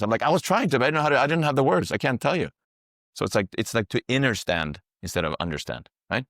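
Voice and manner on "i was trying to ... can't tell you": high, fast pleading reply; flustered excuse. Retold or imitated. The friend answers that he lacked the words, flustered and fast. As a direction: rush the excuse out, helpless.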